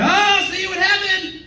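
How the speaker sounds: high, slow recovery; awkward save. Retold or imitated. Comic trying to rescue the moment, high and slow. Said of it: "I was like".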